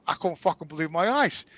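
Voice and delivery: ordinary delivery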